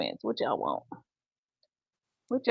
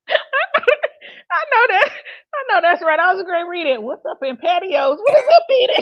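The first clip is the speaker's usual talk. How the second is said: high, loud, talking through laughter; real giggling fit, pitch way up; cracking up; 12 semitones higher, 5 dB louder than usual. Real, not acted. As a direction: laugh at your own misreading as you speak. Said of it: "you hear me cracking up?".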